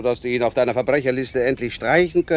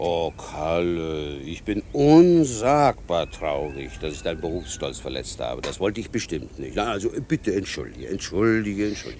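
mock contrition; 7 semitones lower, 3 dB quieter than usual. Policeman teases the boy detective with a mock grieving apology. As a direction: pretend to be deeply hurt, teasingly.

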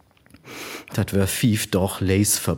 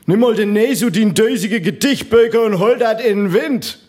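put-on jeering kid voice; 11 semitones higher, 5 dB louder than usual. Boys jeering loudly.

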